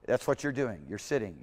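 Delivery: ordinary delivery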